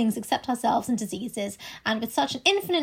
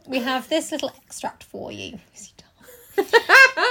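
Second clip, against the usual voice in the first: whispering; 3 semitones higher, 3 dB louder, slower than usual. Real, not acted. Whispered intro to the reading.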